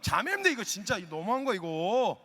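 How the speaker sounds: usual speaking voice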